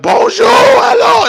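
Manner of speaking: shrill, drawn-out, high-pitched and loud; hammed-up, shouted mock French greeting. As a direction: stretch out a loud, squeaky hello. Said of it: "he's all like".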